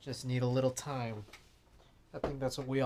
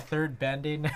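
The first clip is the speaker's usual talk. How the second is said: ordinary talk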